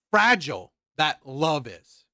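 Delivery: normal talking voice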